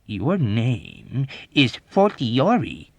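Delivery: slow, emphatic contradiction; heavy, combative correction; belligerent. A narrator's reading. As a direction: insist belligerently, stretching each word. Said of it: "contradicted him belligerently".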